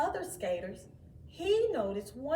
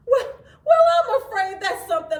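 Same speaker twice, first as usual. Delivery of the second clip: laughing disbelief; 10 semitones higher, 15 dB louder than usual. Acted. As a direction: chuckle through it, amused and doubtful. Father laughs at talking snowman.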